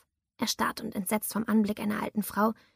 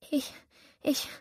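stammering; 5 semitones higher, 3 dB quieter than usual. A narrator's reading. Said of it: "stammelte ich".

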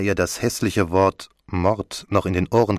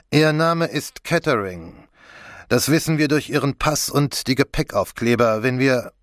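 lightly voiced official, pitched higher; commissioner; 6 semitones higher than usual. Commissioner tells Catherine what the passport shows, mildly official. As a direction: state the known facts as a police commissioner, lightly played.

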